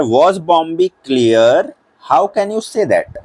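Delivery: drawn-out question read; teacher reading the question slowly; slow reading aloud. Shown as a demonstration.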